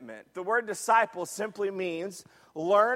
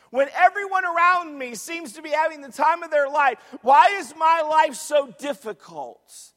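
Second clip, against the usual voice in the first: loud, raised-pitch pleading complaint; acted exasperated questioning; 5 semitones higher, 8 dB louder than usual. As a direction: pile up the questions like a frustrated believer. Voicing struggling believers' complaints.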